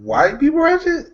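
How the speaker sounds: high, incredulous echo; surprised question. Real, not acted. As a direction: echo it back in disbelief.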